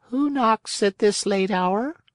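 high, otherworldly snail voice; a high little creature calling down. Acted. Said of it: "she called".

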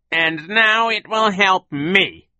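slow, high, loud villain voice; gloating villain. A narrator's reading. Count Olaf readies his escape with the book, slow and gloating. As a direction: stretch each word out in triumph.

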